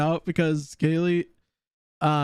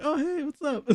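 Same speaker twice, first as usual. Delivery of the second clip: mock cheerful greeting; 12 semitones higher, 4 dB quieter than usual. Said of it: "just like".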